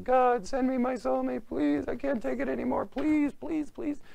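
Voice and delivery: very high, wailing plea; mock crying prayer. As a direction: beg God in a hammed-up tearful falsetto. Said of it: "every day crying at the kotel".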